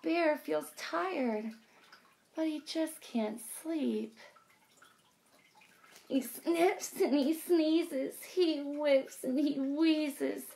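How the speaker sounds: lively, sing-song picture book read; reading to children. A narrator's reading. Teacher reading aloud to kids, lively sing-song. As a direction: draw the little ones into the story.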